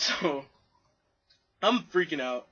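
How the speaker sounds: ordinary talk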